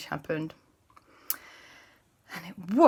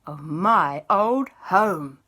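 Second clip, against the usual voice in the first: ghost voice; 4 dB louder, slower than usual. Acted. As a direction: moan it like a spooky ghost. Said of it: "The ghost went".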